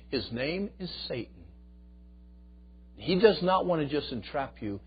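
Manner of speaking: ordinary delivery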